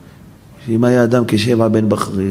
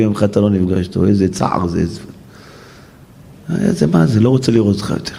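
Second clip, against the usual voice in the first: ordinary talk, nothing marked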